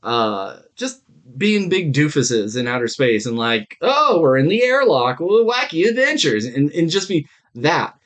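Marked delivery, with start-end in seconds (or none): none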